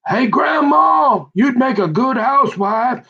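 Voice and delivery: high, slow, mocking drawn-out taunt; sing-song mock insult, stretched out high; mocking jeer. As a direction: jeer at a slow driver from a passing car, high and slow. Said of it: "giving me the bird left and right, going".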